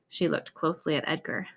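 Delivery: plain reading voice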